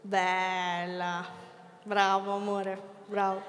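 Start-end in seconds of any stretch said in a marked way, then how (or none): none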